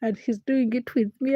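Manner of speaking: ordinary talk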